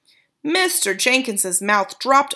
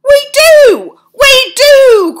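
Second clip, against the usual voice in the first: very high, very loud; panicked father; crying out; 15 dB louder, much slower than usual. A narrator's reading. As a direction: cry it in alarm. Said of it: "cried Mister Jenkins".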